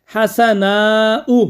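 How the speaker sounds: हसनाउ stretched out long, every sound held; very slow example word. Shown as a demonstration. Teacher to students, pronouncing the example very slowly. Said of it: "जैसे".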